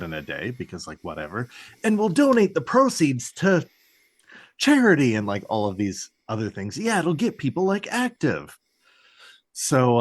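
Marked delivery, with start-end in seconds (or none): 1.8-5.1 high, louder, over-eager do-gooder voice; mock wholesome teen enthusiasm; mock eager kids. 6.8-8.6 mock eager kids.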